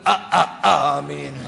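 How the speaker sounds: a stuttered before amin, breaking into a laugh; mock stammering congregant. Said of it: "Makmum pun".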